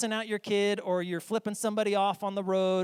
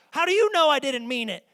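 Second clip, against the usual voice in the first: high loud indignant retort; angry kid; 9 semitones higher, 10 dB louder than usual. Retold or imitated. Kid defending a forced apology to parent, loud and indignant. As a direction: fire it back, offended. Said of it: "'Cause you're angry when you say it".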